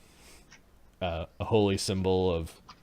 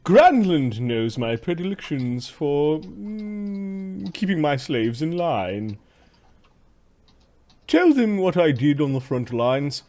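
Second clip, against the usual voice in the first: haughty slave master; 6 semitones higher, 4 dB louder, slower than usual. Acted.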